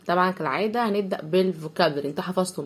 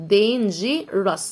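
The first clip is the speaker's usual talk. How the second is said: "dangerous" very slow, dain-jee-rus; split syllables; much slower than usual. Shown as a demonstration. Teacher breaks the word up for pupils, slow "dangerous". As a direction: break "dangerous" into three parts. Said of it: "نقسمها الأول".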